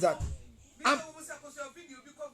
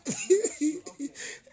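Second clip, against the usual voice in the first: high helpless giggle; weepy fit of laughter; tearful laugh; 4 semitones higher, 4 dB quieter than usual. Real, not acted. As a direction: laugh until you're crying. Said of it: "Sorry, I'm crying".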